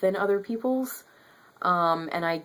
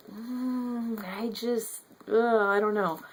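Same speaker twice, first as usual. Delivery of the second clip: high, hesitant groaning reluctance; mock frustration; 5 semitones higher, slower than usual. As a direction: struggle aloud with mixed feelings, pitched up. Acts out frustrated reaction while reading.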